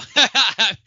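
sudden loud burst of laughter. Real, not acted. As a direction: break out laughing loudly. Laughing at host wrapping up.

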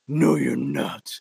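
drawn-out low no you're not; lightly voiced domineering woman; slow low refusal. Strong woman refuses to let go.

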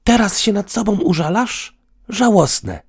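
almost shout it at him; near shout. A narrator's reading. Woman scorns partner's self-pity, almost shouting. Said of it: "krzyknęła niemal".

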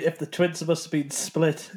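ordinary delivery

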